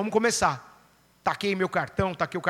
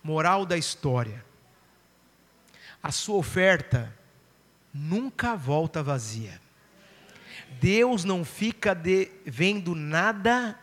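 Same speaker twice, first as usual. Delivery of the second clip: slow, lowered, weighty delivery with long pauses; solemn moral; 4 semitones lower, 4 dB quieter, slower than usual. Real, not acted. Preacher giving the congregation the moral of the story, slow and solemn. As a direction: let each phrase sink in, slow and low.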